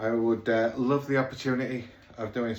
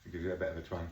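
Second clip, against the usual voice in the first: low aside; 7 semitones lower, 9 dB quieter, much faster than usual. Shown as a demonstration. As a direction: lower and softer, muttered to himself. Repeats the line to himself.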